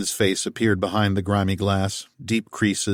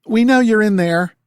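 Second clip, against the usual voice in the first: higher, louder southern holler; down-home call; 13 semitones higher, 8 dB louder than usual. A narrator's reading. Martha calling to Tal through the door, down-home accent.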